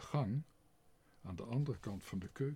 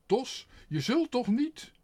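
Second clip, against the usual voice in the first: stammering in fear; 13 semitones higher, 11 dB louder than usual. A narrator's reading.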